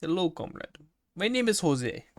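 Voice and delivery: put-on high, comic Russian accent. Co-host introducing himself as comrade, mock Russian accent. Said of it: "could say in a Russian accent".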